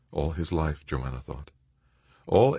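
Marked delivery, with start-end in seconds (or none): none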